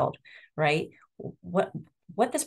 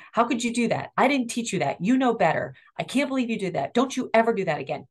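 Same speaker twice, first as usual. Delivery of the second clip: imitating a parent telling a kid off; mock angry parent; scolding parent; 6 semitones higher than usual. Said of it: "They're used to us saying".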